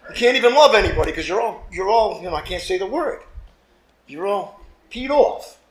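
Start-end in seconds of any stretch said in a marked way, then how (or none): none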